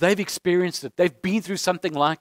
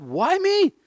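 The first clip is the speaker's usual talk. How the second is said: high, plaintive why me; a pleading complaint to heaven; mock lament to God; 8 semitones higher than usual. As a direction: cry out to God in self-pity. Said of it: "I've often said to God".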